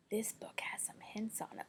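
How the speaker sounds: soft breathy whisper. Real, not acted. Teacher whispers to kids about hints. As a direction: whisper it like a secret.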